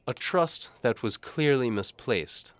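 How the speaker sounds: high, slow, loud disdainful lady; Lady Antimony coldly dismissing the knight; haughty lady. A narrator's reading. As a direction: look down your nose at him. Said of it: "says Antimony".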